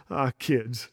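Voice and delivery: ordinary talk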